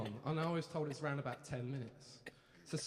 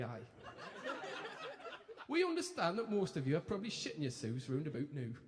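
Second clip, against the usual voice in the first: lightly put-on Geordie TV presenters; chirpy Geordie telly hosts; a hint of Ant and Dec's Geordie patter; Geordie accent; 3 semitones higher than usual. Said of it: "Apologies for the accent now".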